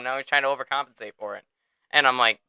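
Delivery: usual speaking voice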